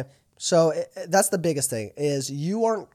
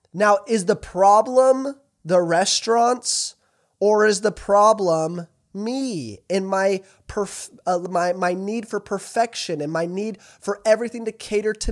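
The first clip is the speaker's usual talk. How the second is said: emphatic challenge; 5 semitones higher, 5 dB louder than usual. Real, not acted. Preacher confronting the audience.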